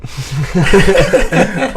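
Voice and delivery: laughing through the words. Real, not acted. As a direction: let the laugh spill over the line.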